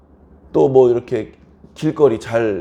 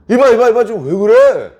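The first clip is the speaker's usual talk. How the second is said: loud, high acted calling out; alarmed protest; 8 semitones higher, 6 dB louder than usual. Victim to neighbour shooting arrows like a madman, alarmed protest. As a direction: urgently try to stop the attacker.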